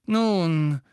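gedehntes, zögerndes Nun, angehoben; langsam. A narrator's reading. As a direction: das Wort bedächtig in die Länge ziehen. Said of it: "sagte Lupin langsam". (langsam und gedehnt)

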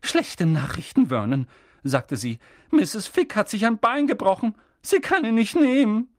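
worried complaint to her husband; fretful Aunt Petunia; angry, worried aunt. A narrator's reading. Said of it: "mit zornigem und besorgtem Blick".

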